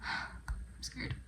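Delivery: soft breathy whisper after a sigh; whispered fear over a sad book; scared whisper. Real, not acted. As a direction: whisper it, on the edge of tears. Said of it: "I just cried".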